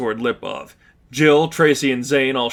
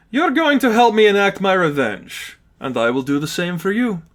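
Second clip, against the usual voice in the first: high, smug villain voice; gloating villain; 8 semitones higher than usual. Acted. Lipov claiming his new servants, gloating villain voice. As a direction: savour the power.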